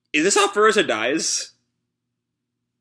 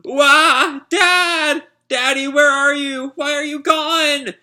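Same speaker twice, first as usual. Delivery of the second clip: put-on much higher, louder wailing child voice; crying child; 14 semitones higher, 5 dB louder, slower than usual. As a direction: wail like a lost little boy. Child self crying for dad.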